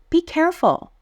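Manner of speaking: high, urgent be careful; excited warning. Shown as a demonstration. Teacher reading the quiz, says it with excitement. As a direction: warn with a burst of excitement. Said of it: "I said this with some excitement".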